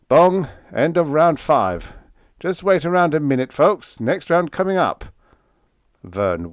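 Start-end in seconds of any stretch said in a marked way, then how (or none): 0.1-5.0 higher, louder mock boxing-announcer call, quick on the folks; a young joker hamming up a fight announcer; cheeky assistant bursting in like a ring announcer, playful; playful ringside announcer. Vern clowning as announcer.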